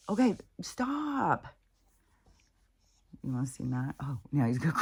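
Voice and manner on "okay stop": high, drawn-out "stop"; exasperated plea. Real, not acted. Telling off a pet crowding her, exasperated drawn-out plea. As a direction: stretch it out as a pleading scold.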